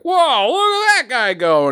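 high, loud amazed cry; amazed onlookers. Retold or imitated. Onlookers calling out as the car passes them. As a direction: gape at the speeding car.

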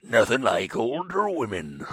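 slow, low Southern drawl; old Southern man voice. Acted. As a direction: savor it like an old sea captain. Keeps the Southern old man bit.

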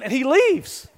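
ordinary talk

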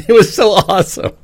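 high laugh through the words; giggling delight. Real, not acted. Laughing at his own dream.

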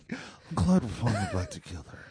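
quiet, dull imitation of a radio DJ; mocking radio DJ voice. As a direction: echo it back like a bored DJ. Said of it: "you sound like a college radio D J".

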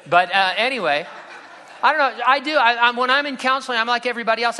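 high, loud, laughing admission; sheepish high-pitched confession; flustered laughing. Real, not acted. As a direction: blurt it out half laughing.

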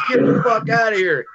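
high, shouted incredulous reaction; retold disbelief. Bandmate told it is his own old band, incredulous. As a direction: mimic a bandmate crying out in disbelief.

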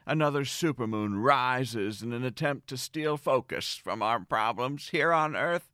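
ordinary talk, nothing marked

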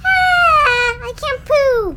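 high, loud straining whine; straining banshee voice. Retold or imitated. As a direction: strain like a constipated monster. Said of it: "banshee's sitting on the toilet going".

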